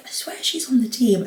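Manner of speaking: half-whispered retold suspicion; hushed aside. Retold hushed remark about nearby goalkeeper.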